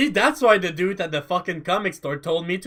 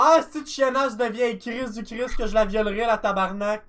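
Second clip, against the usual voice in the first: Québécois cursing rant; 6 semitones higher than usual. Acted. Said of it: "Nobody's gonna understand that".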